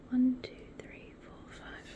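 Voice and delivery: barely audible whisper to yourself; whispered counting. Real, not acted. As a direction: count under your breath. Counts to self under breath.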